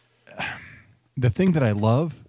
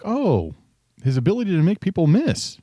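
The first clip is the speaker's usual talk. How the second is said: higher, pleasantly impressed mock reaction; imagined excited reaction; 8 semitones higher than usual. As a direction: voice an imagined delighted scout, pitched up. Imagined scouting report reaction.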